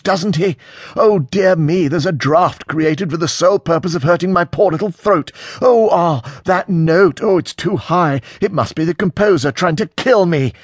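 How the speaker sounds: high, loud theatrical hysterics; mock a precious singer in melodramatic distress; hysterical sarcasm. A narrator's reading. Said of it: "his voice beginning to sound no less hysterical".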